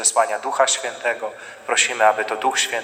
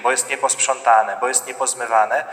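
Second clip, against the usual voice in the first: ordinary delivery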